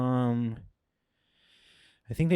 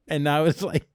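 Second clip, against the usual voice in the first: ordinary talk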